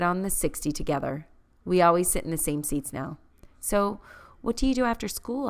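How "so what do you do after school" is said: sudden nervous blurt, rising. A narrator's reading. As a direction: blurt it out before thinking. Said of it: "I blurt out".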